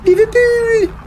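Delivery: sudden very high squeal on "Liverpool!". Pretend. Squeals "Liverpool!" to chat, high shriek. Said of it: "that's more Liverpool".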